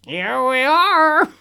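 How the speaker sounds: high, slow, gleeful goblin voice. Acted. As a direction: announce it like a mischievous little goblin. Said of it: "the goblin suddenly said".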